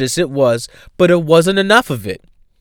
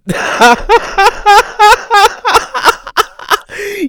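high breathless crying laugh; laughing fit. Real, not acted. Comic laughing at his own wedding story, high cry-laugh. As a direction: crack up, laughing until it squeaks.

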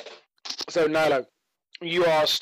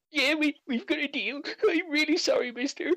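put-on high, snivelling child apology; a scared halfling boy, still snotty; sniffling scared kid; 13 semitones higher than usual. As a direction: apologise meekly through tears. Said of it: "snot bubble on his nostril".

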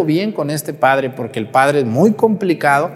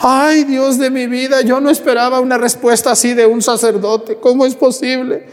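mock tearful wail; 11 semitones higher, 3 dB louder than usual. Offended lady answers the priest's rebuke, hammed-up tearful wailing. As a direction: wail like a scandalized parishioner about to cry. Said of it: "Y empezó a llorar".

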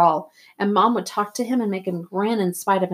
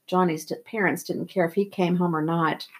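usual speaking voice

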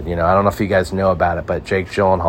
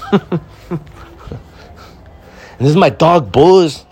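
much higher, louder put-on tough-guy voice; Tom Hanks as street thug; 9 semitones higher, 4 dB louder, slower than usual. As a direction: proudly present the dog in the silly voice. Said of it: "You think Tom Hanks is gonna take a role on as a... street thug".

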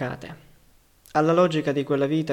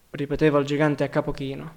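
ordinary delivery

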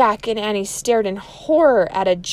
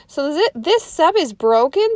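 higher, louder anxious question; worried Annie; 8 semitones higher, 5 dB louder than usual. Acted. Teacher voicing Annie, worried about the broken sub. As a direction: ask it half panicked.

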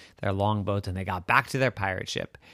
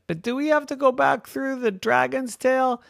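high-pitched whining question; reluctant pleading; 5 dB louder than usual. Acted. Captain dreads the way back through the dragon's tail, reluctant whine.

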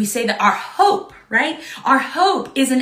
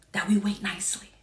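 whisper; 4 semitones lower, 8 dB quieter, faster than usual. Real, not acted. Whispered ending.

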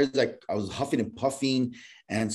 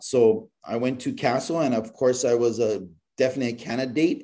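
ordinary delivery

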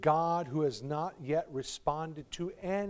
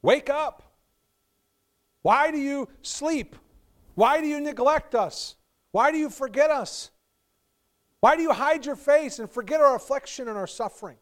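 high, loud lament crying out to God; pleading cry; 11 semitones higher, 13 dB louder than usual. Acted. Psalmist begging God to wake up, loud anguished cry.

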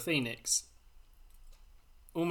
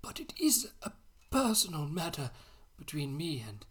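put-on high, breathy old wizard, fading to a whisper; a mock Dumbledore, half whispered; old man's voice; 5 semitones higher, 7 dB quieter, slower than usual. Reading Dumbledore's reply to Harry in old man's voice. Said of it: "Just gotta put on an old man's voice".

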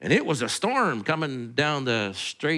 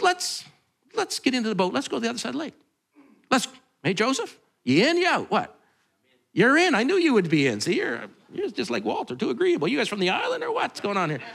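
playful invitation; 6 semitones higher than usual. Retold or imitated. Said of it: "He says".

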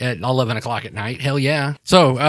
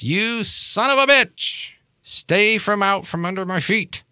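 Maureen; 9 semitones higher than usual. Acted. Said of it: "you hear Maureen's voice".

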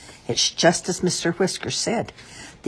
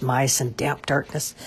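ordinary delivery

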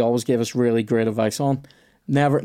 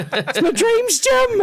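much higher, squeaky mock hurt; mock wounded; 4 dB louder, much slower than usual. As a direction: pretend your dreams were just crushed. Mocking his own wounded pride.

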